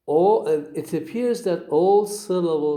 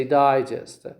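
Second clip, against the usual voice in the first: digest with stress moved to the front, DI-gest; first-syllable stress; 3 dB louder than usual. Shown as a demonstration. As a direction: punch the DI in digest. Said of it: "You never say digest".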